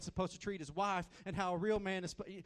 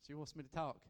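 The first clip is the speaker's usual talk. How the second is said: usual speaking voice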